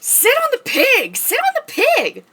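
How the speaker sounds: high, loud squeaky voice; squeaky character voice. Pretend. Chicken character urging cat, high loud squeak. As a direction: squeak it out like a little critter.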